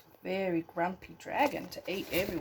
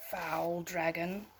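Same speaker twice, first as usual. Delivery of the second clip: put-on gentle storybook read for a child at bedtime; bedtime story narration. A narrator's reading. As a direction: tell it like a bedtime tale, unhurried.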